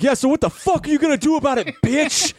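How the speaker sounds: high, aggressive taunt; mock yell of a stranger picking a fight; imitated yelling. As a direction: pitch it up into a hostile challenge. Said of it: "starts yelling shit".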